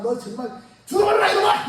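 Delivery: mock furious shout, climbing high on the last word; hammed-up curse. Preacher acts out cursing his sick enemy, a mock angry shout. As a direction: yell the curse at the enemy, half in jest. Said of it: "이렇게 생각할 것 같아".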